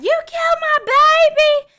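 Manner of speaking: high, loud screaming accusation; furious accusation. Retold or imitated. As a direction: scream it at the killer. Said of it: "So she's like".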